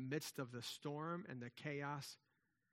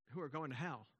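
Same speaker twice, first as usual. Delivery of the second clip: usual speaking voice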